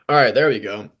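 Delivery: ordinary talk, nothing marked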